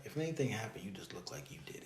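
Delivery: hushed whisper; girl from college in a low whisper. Retold or imitated. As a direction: whisper it like a secret. Girl's blunt remark retold.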